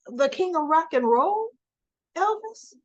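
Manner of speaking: retold disbelief, pitched high at the end; incredulous question. Woman questioning his choice of muse, mock incredulous. Retold astonished reply to a revelation.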